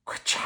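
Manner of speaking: soft whispered thunder crack; whispered ka-chow. Acted. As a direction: hush the thunder sound. Thunder sound effect.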